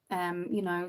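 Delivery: usual speaking voice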